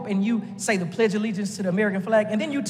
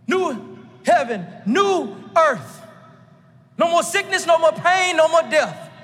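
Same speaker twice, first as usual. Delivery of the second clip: fervent preaching; 5 semitones higher than usual. Acted. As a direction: build the list, voice lifted and ringing. Preaching the hope.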